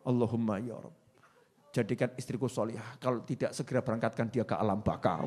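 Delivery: low, soft imitated prayer of a fed-up husband; husband quietly praying for his wife to die; husband's mock prayer. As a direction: pray it low and hushed as the weary husband.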